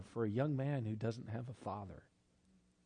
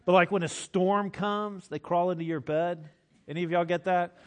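louder, rousing storytelling to the room; lively preaching; 7 dB louder than usual. Acted. Preacher telling the congregation about his kids in the storm, loud and rousing.

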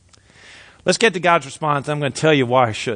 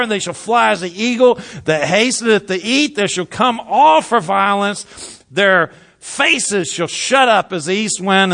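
higher, emphatic declaimed Bible verse; scripture reading; 5 semitones higher than usual. A narrator's reading. Preacher reads out the coming invaders, forceful declamation. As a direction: proclaim the prophecy to the congregation.

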